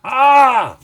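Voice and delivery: sharp high cry of pain; pained yelp. Retold or imitated. Stung worker crying out at the sting, sharp yelp. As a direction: yell like you just got stung. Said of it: "And I was like".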